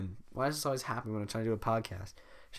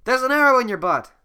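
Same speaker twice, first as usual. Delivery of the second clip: shocked gasp; 13 semitones higher, 13 dB louder than usual. Acted. Donkey gasps at the arrow.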